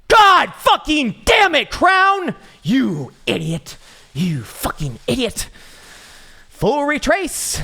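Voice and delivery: shrieking high mock rage; furious squeaky shout. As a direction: yell at Crown like a furious cartoon. Shouting at Crown in character.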